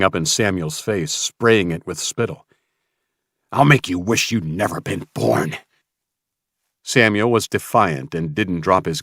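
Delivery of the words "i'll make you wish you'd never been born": lightly voiced menacing villain; menacing threat. Mason threatening Samuel, a hint of menace. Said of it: "Mason roared".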